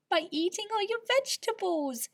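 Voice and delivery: put-on high, eager child's answer; excited cry. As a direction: blurt it out with sudden joy. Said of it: "he cried".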